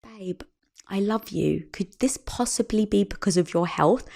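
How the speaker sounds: husband calming his crying wife, soft and tender; gentle reassurance. Retold or imitated. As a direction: soothe her gently, almost hushed. Husband comforts her in restroom.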